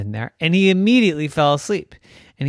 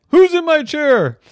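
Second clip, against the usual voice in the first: indignant principal; 12 semitones higher, 7 dB louder than usual. Acted.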